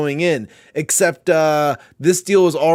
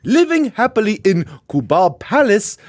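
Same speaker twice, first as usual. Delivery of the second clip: put-on reading in a light African accent; a hint of an African accent; 3 semitones higher than usual. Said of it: "we have to do an African accent".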